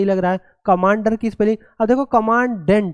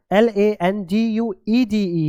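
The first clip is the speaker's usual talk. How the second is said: slow spelling out; much slower than usual. Shown as a demonstration. Teacher spells language slowly.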